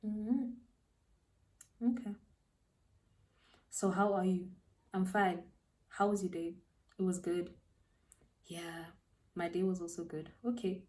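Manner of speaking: quiet, halting, reluctant replies; awkward small talk. Retold or imitated. Replaying the walk to hostel with the unwanted date, awkward and quiet. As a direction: act out stilted chat with long gaps, softly.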